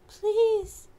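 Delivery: very high pleading; begging bee. Acted. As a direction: beg like a tiny bee. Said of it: "Phoebe begged".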